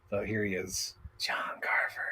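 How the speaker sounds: hushed whisper. Real, not acted. Vlogger whispering as he reveals the figure's backdrop. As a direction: whisper it like a secret. Whispering while showing toy.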